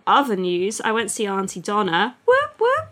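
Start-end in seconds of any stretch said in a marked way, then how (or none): 2.3-2.9 very high-pitched little whoops; playful whoop. Pretend. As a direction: whoop it up high, like a siren blip. Playful whoop after naming the show.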